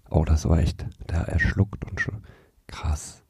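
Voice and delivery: hushed commentator whisper. Pretend. Whispered commentary while Erik drinks.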